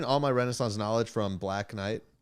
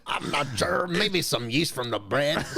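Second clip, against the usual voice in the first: higher, slowed-down peasant voice; Renaissance voice; 4 semitones higher than usual. Pretend. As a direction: lightly play a confused tavern keeper. Answering in Renaissance character.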